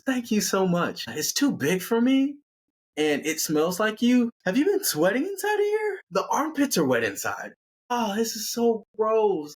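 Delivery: high-pitched put-on girlfriend; thanks sliding into high, grossed-out complaint; hammed-up squeamish whine up high; fussy Amy voice. Amy's acted thanks, then complaint.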